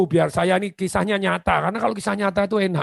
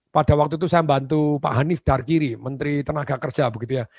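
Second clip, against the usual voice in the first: ordinary talk, nothing marked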